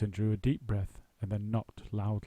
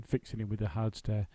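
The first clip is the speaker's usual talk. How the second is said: ordinary delivery, nothing marked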